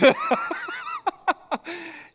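a long high squeal of laughter. Real, not acted. Preacher laughing at himself over the foot story, high squeal. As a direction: laugh high at your own blunder.

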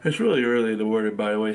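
talking through a yawn; stretched, sleepy yawn in the words. Real, not acted. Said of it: "Sorry for the yawning".

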